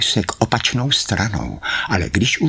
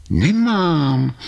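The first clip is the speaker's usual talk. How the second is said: put-on a higher, girlish Karkulka; Karkulka's voice; 11 semitones higher than usual. Karkulka answering the wolf, in a high girlish voice. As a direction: answer the wolf brightly as the little girl.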